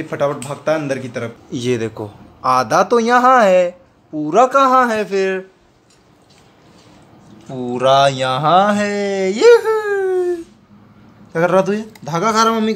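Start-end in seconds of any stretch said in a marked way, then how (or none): 2.4-9.3 sing-song teasing. Real, not acted. Teasing peekaboo with the dog. 9.3-10.4 squealed yoohoo. Real, not acted. Playful cry to the dog.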